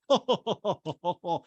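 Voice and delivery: pulsing mock ho-ho chuckle; Mauricio's laugh. Podcaster imitating Mauricio not listening, put-on pulsing laugh. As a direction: fake a string of hollow ohs. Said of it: "Mauricio just laughs".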